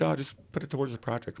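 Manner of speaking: put-on young man offering. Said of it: "He's like".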